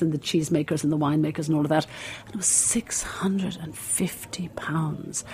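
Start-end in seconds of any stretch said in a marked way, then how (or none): 2.3-5.2 hushed whisper. Retold or imitated. Telling of the course price, a hushed whisper.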